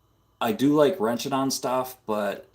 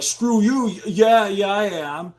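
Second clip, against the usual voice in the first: high, loud hammed-up shout back; mock angry retort; 10 semitones higher, 5 dB louder than usual. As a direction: yell it back at your wife like a sulky kid. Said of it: "And I'm like".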